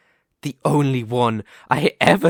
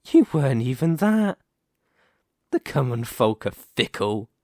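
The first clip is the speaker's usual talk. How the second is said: ordinary talk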